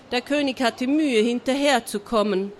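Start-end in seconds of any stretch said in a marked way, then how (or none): none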